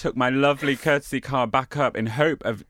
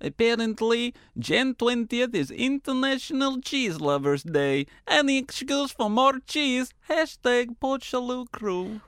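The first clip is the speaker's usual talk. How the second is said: higher, slow vampire reading voice; 8 semitones higher, slower than usual. Pretend. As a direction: read it as a lightly hammed vampire. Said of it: "vampire laugh to a sort of vampire voice".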